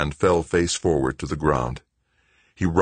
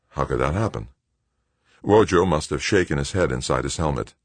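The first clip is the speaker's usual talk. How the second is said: ordinary delivery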